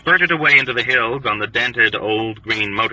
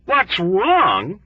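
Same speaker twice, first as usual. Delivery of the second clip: cried; 9 semitones higher than usual. A narrator's reading. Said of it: "Doktonika cried".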